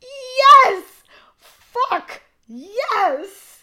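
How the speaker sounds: very high, loud triumphant yells; ecstatic cheer. Retold or imitated. Fan reliving the gotcha moment, ecstatic. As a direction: scream each word like a winning fan.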